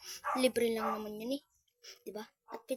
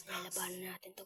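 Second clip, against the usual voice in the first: whispering; 3 semitones lower, 8 dB quieter than usual. Real, not acted. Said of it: "whispered aside while fleeing".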